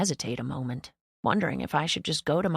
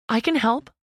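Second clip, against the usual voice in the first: bright eager offer, pitched up and louder; 6 semitones higher, 7 dB louder than usual. A narrator's reading. Mother eagerly offering to help with puppies. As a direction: jump in to help, keen. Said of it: "with eagerness".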